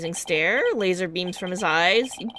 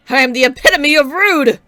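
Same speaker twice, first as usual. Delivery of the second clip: louder, higher booming declaration; loud self-scolding; 6 semitones higher, 10 dB louder than usual. Acted. Mayor scolds himself for rudeness, booming loud. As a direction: bellow it with theatrical shame. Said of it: "I get a little loud sometimes".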